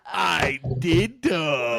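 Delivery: very slow creepy drawl. Pretend. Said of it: "excuse to do creepy voice".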